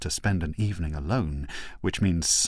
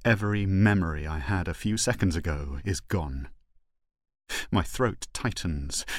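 plain reading voice

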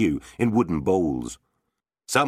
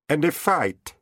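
calm knight; 10 semitones higher than usual. Acted. Knight answers with a threat.

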